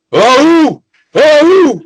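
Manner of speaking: soldiers' cheer. Acted. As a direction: whoop like a whole squad answering their queen. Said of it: "you hear them all go".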